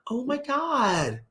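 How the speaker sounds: high, drawn-out mock amazement.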